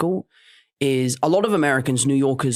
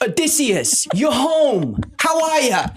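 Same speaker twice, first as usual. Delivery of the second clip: put-on New York Queens accent; 10 semitones higher, slower than usual. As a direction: go full Queens New Yorker. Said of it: "like, New York Queens".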